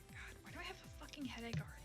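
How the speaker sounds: ordinary delivery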